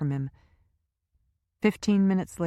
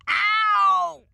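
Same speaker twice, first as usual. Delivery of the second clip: a sudden, much higher, louder "ow" cutting the sentence off; yelp of pain; 9 dB louder than usual. A narrator's reading. Powers cut off mid-insult by Lynch's grip, sharp yelp.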